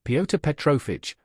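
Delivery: ordinary delivery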